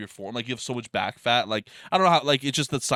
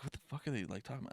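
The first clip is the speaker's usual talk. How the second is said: much softer, muttered under the breath; quiet confused aside to yourself; bewildered mutter; 16 dB quieter, much faster than usual. Retold or imitated. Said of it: "I'm like".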